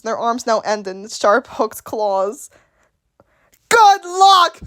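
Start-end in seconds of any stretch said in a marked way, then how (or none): 3.7-4.6 loud, high mocking cry; high-pitched sarcastic holler; incredulous shout. Real, not acted. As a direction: shout it in scornful disbelief. Mocking the clawed drones, calling out.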